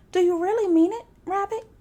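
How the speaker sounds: loud, high eager plea; excited cry. A narrator's reading. Tigger begging Rabbit for his bounces, loud and excited. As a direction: burst out with hope. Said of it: "he cried".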